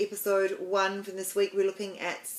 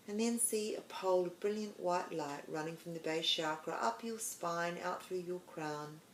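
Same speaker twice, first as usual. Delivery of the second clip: soft, hushed meditation voice with long pauses; guided meditation; 10 dB quieter than usual. Acted. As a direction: ease the listener gently inward, much softer.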